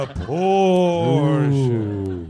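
very slow, stretched-out repeat of "An abortion"; a light eerie drawl; spooky slow echo. Retold or imitated. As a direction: linger on "An abortion" like a ghost story line. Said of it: "she said it just like that".

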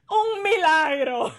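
high, loud mock cry of wonder; hammed-up Latin American señora hailing a miracle; exclaiming old lady. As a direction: imitate an old lady crying out in Spanish. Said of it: "La Señora is like".

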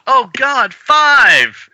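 startled cry. Acted. Character cries out in comic.

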